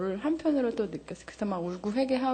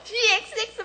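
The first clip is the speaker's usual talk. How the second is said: retold curse yell; 12 semitones higher than usual. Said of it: "막 소리 질렀어요".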